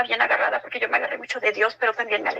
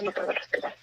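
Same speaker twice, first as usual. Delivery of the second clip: softer, unvoiced, barely-there gasp; faint breathless voice; 7 dB quieter than usual. Son tells his mother he can't breathe, faint and breathless. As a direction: say it like there's no air left.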